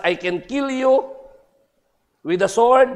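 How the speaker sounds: high, loud devil threat; Satan threatening. Acted. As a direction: loom over him as the devil. Satan threatens old man.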